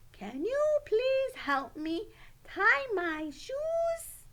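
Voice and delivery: high, loud, slow polite request; polite, strong voice. Retold or imitated. Child asking the teacher for help with shoes, politely and strongly. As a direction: ask politely in a strong, drawn-out voice. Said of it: "they asked in a politely strong voice".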